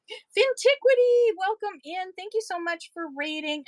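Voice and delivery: bright streamer welcome to a raid; excited welcome. Real, not acted. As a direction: greet the raider with a big happy call. Greeting a streamer who raided.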